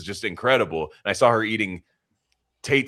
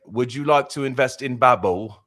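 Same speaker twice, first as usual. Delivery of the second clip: mock British commercial pitch, slightly louder and higher; British accent; 5 semitones higher, 5 dB louder than usual. Podcaster mimicking a language app commercial, exaggerated British accent. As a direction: put on an odd British salesman accent.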